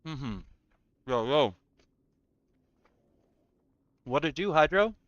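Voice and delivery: talking with food in mouth; words round a mouthful; chewing while talking. Real, not acted. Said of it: "Sorry for the chewing".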